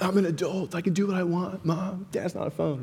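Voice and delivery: mock indignant college kid, pitched high; whining young adult protest. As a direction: imitate yourself whining at your parents. Acts younger self arguing with parents.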